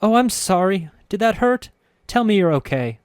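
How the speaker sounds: high, loud anxious apology; worried she hurt him; frightened apology. A narrator's reading. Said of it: "shocked and frightened that she hurt me".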